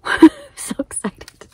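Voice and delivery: laughing hushed squeal; excited whisper. Real, not acted. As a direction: whisper it bursting with glee. Laughing over the tea gift.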